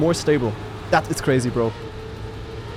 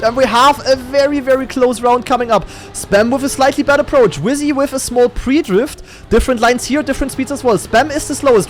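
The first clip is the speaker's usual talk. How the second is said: high, excited esports race call; energetic caster following the cars; live play-by-play; 7 semitones higher, 3 dB louder than usual. Real, not acted. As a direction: keep the commentary urgent and pitched up.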